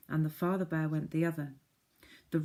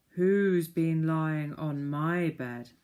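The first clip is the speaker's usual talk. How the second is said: slow, louder growly bear voice; growly father bear; 6 dB louder, much slower than usual. Acted. Father bear finding his bed, slow and growly. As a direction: growl it slowly. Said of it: "in a growly voice".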